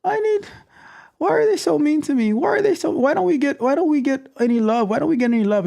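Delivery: high-pitched whiny mock sobbing; mock crying. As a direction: imitate a crybaby pleading for love. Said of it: "it's like they're crying".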